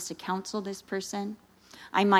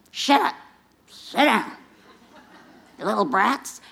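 mock scolding teacher; 3 semitones higher, 5 dB louder than usual. Uncalled teacher scolding kids.

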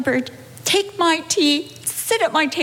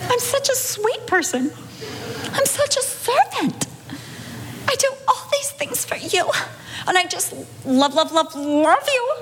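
higher, self-pitying prayer voice; whiny plea; 6 semitones higher, 3 dB quieter than usual. Retold or imitated. Wife complaining to the Lord, whiny and higher. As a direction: whine to the Lord about being so sweet. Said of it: "saying to the Lord".

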